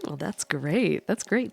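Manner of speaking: plain reading voice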